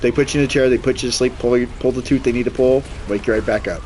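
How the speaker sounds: usual speaking voice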